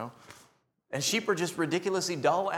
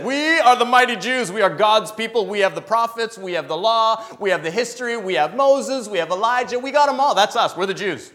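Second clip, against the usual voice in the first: loud, higher boastful voice of the proud insiders; 4 semitones higher, 7 dB louder than usual. Retold or imitated. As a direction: boast like the chosen people looking down.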